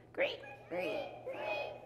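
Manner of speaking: imitated squeaky creaks; creaking steps. Storyteller imitates front steps with children, creaking. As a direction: creak like old wooden steps. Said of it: "what the front steps did".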